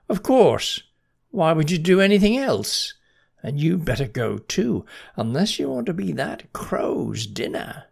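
high, loud bossy ant voice; a busy little ant scolding; tiny marching ant. Acted. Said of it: "looked about and said".

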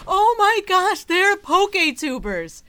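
high, loud excited squeal. Real, not acted. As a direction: squeal with delight. Delighted at cute promo.

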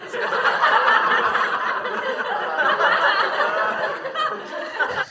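long, high real laugh; long, high laugh at the teasing; high burst of laughter. Real, not acted. Reacting to a joke.

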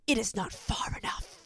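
imitating an intense whispered confession; hushed, melodramatic impression of a TV hero; whispered TV quote. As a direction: whisper it softly, like a period-drama lover. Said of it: "where he's like".

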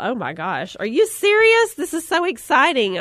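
squealing high with delight; thrilled. Retold or imitated. Friend offers a free house, she squeals with high excitement. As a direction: gush like you just won a free weekend away. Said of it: "I was so excited".

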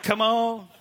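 loud, exasperated plea to a machine; mock impatience. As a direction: act fed up with the cash machine. Frustrated customer at the cash machine.

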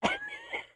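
a sudden high squeal of laughter; high laugh burst. Real, not acted. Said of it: "I died of laughter".

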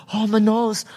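high, overacted cry over a hurt nose; hammed-up pain. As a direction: ham it up like a stuntman who got hit. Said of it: "so he hams out".

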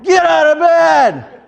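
high loud slow yell with a laugh. Retold or imitated. Wife yelling at her son to get up. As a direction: bellow the wake-up order.

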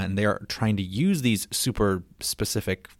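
ordinary talk, nothing marked

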